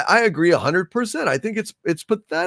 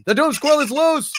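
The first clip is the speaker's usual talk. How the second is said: hammed-up alarm; 10 semitones higher than usual. Hammed announcement of squirrel.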